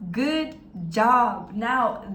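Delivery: ordinary talk, nothing marked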